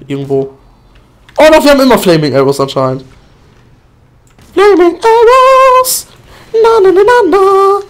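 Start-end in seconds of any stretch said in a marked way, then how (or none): 4.5-7.8 drawn-out shrill cartoon voice; high squeaky cheer. Sniper gleeful about flaming arrows in a high squeak.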